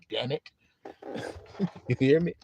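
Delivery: ordinary talk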